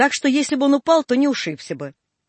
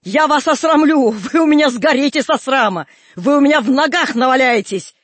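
loud, higher threats; vengeful screaming; 3 semitones higher, 7 dB louder than usual. A narrator's reading. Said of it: "кричала Аксинья".

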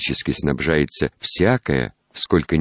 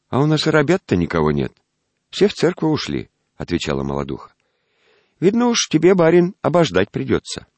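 peasant woman voice; 10 semitones higher than usual. Acted. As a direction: answer like a brisk peasant wife. Young peasant woman answers.

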